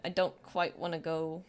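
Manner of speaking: ordinary talk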